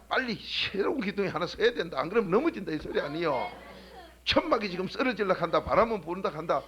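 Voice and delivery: loud, driving sermon voice; preaching at full force. Acted. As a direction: preach it hard to the pews. Preacher exhorting the congregation.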